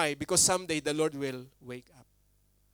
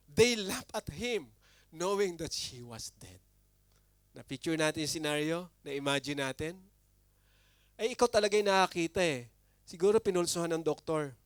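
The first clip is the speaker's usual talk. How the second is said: ordinary talk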